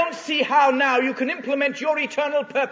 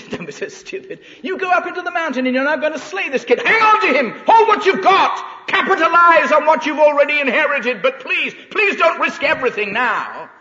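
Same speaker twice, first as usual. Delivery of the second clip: mocking tempter, higher and louder; mocking inner voice; 5 semitones higher, 7 dB louder than usual. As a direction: taunt him, then plead. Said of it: "a little tiny voice ... mocking him".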